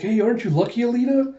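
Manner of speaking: light, high-pitched congratulation; high voice climbing to the name; mild teasing. Retold or imitated. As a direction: lilt upward, lightly teasing. Lou teasing Alita about Ito.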